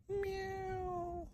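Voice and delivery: very high, soft meow; distant meow. Acted. Cat crying from inside the cave, high and faint. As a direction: mew softly from far off.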